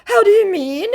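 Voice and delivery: very high, loud put-on scammer; squeaky mock scammer. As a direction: squeak it like a caught fool. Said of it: "my scammer comes back with".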